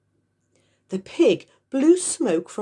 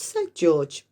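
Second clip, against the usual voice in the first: ordinary delivery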